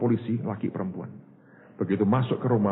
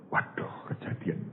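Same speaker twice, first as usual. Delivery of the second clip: shocked whisper; 4 semitones lower, 9 dB quieter, slower than usual. Retold or imitated. Husband hears shocking phone message.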